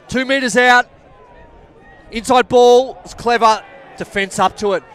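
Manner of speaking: thrilled commentator calling a try-line stand; excited commentary. Acted. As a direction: roar the big hit like the crowd just erupted. Big defensive hit in play.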